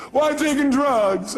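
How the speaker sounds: high, slow mock wail; drawn-out imitation of a caller's complaint; protesting caller. Caller listing what she tried, high slow mock wail. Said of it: "She goes".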